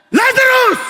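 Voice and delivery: loud high call of a name; commanding shout across a tomb; calling Lazarus. Shown as a demonstration. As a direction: shout the name as if raising the dead.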